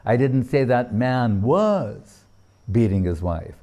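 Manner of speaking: weight on "was"; stress on WAS. Shown as a demonstration. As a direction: land hard on "was". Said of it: "According to where the emphasis is placed".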